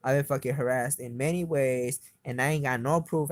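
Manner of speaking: normal talking voice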